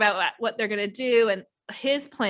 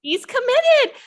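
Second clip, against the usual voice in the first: emphatic agreement; 14 semitones higher, 7 dB louder, slower than usual. Real, not acted. Echoing friend's remark with emphasis.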